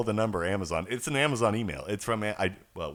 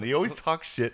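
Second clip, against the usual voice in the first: normal talking voice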